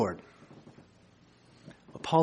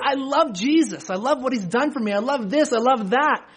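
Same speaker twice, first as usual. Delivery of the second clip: mock gushing believer; 8 semitones higher than usual. Mocking people who claim love.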